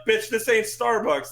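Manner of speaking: mock teacher's reprimand; retold scolding. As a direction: retell how you set a student straight, drawn out. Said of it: "had to get on and be like".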